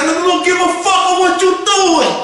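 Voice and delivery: high, loud, menacing yell; furious shout. Retold or imitated. Uncle grabbing the two boys, high furious yell. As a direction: grab them and lay down the law.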